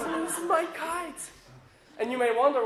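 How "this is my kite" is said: put-on high, slow little-kid whine; hammed-up, drawn-out tearful protest; mock crying. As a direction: imitate a devastated small boy wailing. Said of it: "and he's crying".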